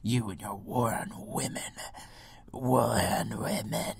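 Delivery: whispering a mocking quote; sneering whisper, slowing on war on women. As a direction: hiss the accusation under your breath. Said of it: "they're like".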